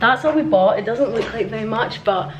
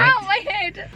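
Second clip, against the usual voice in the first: pained yelp; 13 semitones higher, slower than usual. Real, not acted. Bumps head while lifting.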